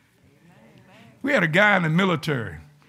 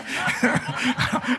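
breathy laugh; 5 semitones higher than usual. Real, not acted. Laughing at his own confession.

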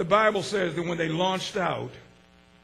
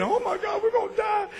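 much higher, frantic wail; panicked cry; 12 semitones higher, 5 dB quieter than usual. Acted. Sailors in the storm crying out they will die, high and panicked. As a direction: squeal it like terrified sailors.

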